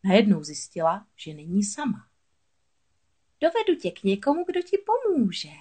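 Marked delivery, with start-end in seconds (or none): 3.4-5.5 high-pitched scheming moray; hissing moray. A narrator's reading. Moray luring Ariel to a helper, high creature hiss. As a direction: voice a sly sea creature, high, drawing out the end. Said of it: "zasyčela Hafie".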